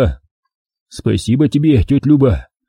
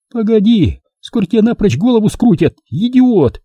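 Milka, scolding; 10 semitones higher than usual. A narrator's reading. Milka berating him as an idiot, high and sharp. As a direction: scold him like a fed-up woman.

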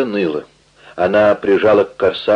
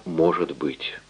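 strained quiet; 4 semitones lower, 10 dB quieter, slower than usual. Acted. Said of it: "Теперь её голос опять звучал, как обычно".

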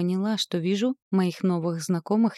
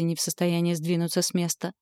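usual speaking voice

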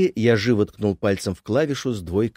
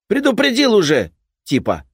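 gruff repairman; 12 semitones higher, 7 dB louder than usual. Acted. Repairman final warning.

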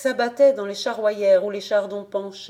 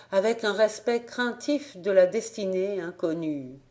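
plain reading voice

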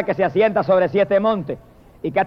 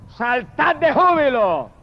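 higher, ringing call to rejoice; jubilant proclamation; 3 semitones higher than usual. Retold or imitated. Preacher voices heaven announcing the Lamb's wedding, jubilant cry. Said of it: "las multitudes del cielo dicen".

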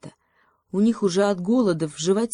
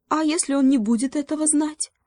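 high, squeaky mouse question; high-pitched mousy query; little mouse; 9 semitones higher than usual. A narrator's reading. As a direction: ask in a tiny creature's high voice.

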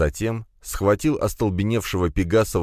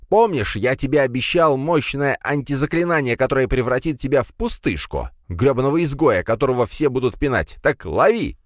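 high-pitched vengeful tirade; angry cry; 7 semitones higher than usual. A narrator's reading. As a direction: rant high and furious, building to the throw. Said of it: "вскрикнул Пегасов".

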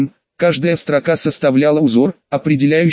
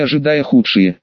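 ordinary delivery

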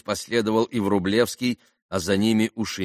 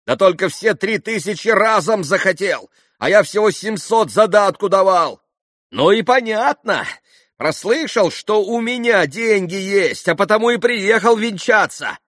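high, loud, indignant outburst; heated accusation; 12 semitones higher, 8 dB louder than usual. A narrator's reading. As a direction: expose him at full voice. Митя продолжает кричать, обличая пана. (горячо, громко обличая)

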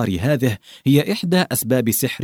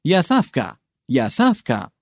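mild narrated call; 3 semitones higher than usual. A narrator's reading. Narrator calls to Savka in the dark, mild call. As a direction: call out a name without really shouting. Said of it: "وصحت أنا".

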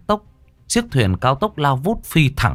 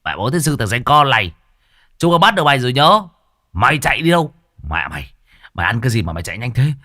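pawnbroker voice; 4 semitones higher, faster than usual. Acted. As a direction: bark at him like a street pawnbroker. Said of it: "dở cái giọng cầm đồ".

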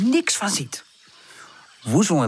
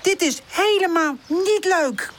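slow, high-pitched scolding; high, indignant storybook voice; cross; 11 semitones higher than usual. A narrator's reading. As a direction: scold slowly, leaning on each word. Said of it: "kijken hem boos aan".

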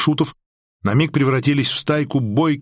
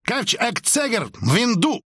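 high, angry bark; harsh barking commands; 8 semitones higher than usual. A narrator's reading. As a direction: snap back furiously. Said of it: "рявкнул он в ответ". (резко рявкает в ответ)

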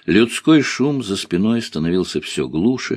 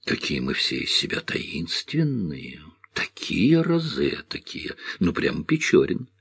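slow, soft, mock-mysterious таинственные; mocking tease; 5 dB quieter, slower than usual. Girl teasing the young man as a Pechorin, slow and soft. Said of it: "рассмеялась она".

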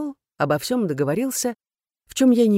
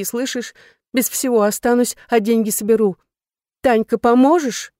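higher-pitched, insistent begging; agitated plea, growing louder toward the end; urgent pleading; 5 semitones higher, 5 dB louder than usual. Acted. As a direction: press them desperately for help. Woman pleads urgently to save Dimka.

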